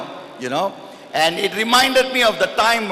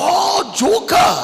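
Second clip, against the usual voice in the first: mock Japanese translator; 11 semitones higher, slower than usual. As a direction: yelp it out like a puzzled interpreter. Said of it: "He said".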